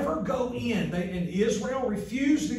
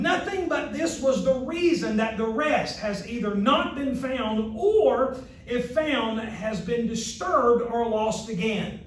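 ordinary talk, nothing marked

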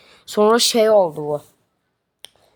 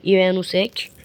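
ordinary talk, nothing marked

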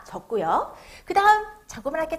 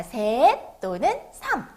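slow, drawn-out read-along words; read-along model; much slower than usual. Shown as a demonstration. Teacher has children read the number three along, slow read-along model. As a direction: stretch each word for children to repeat. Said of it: "같이 따라 읽어볼까요".

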